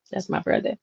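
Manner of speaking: breathy whisper, almost no voice; whispered aside. Real, not acted. Host whispers a joke about Jesus to viewers, whispered. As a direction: lean in and whisper it like a secret joke.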